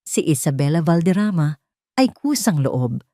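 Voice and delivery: usual speaking voice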